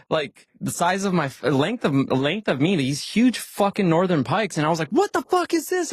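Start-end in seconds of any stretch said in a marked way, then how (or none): 4.9-5.9 sudden very high squeak of disbelief; high-pitched shocked outburst. Retold or imitated. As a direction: yelp it out like you can't believe the size of the fish. Shocked at a huge pike.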